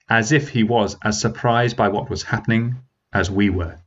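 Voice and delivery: plain reading voice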